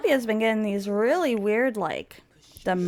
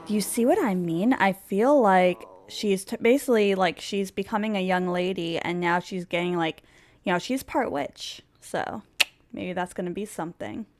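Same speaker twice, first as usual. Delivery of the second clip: normal talking voice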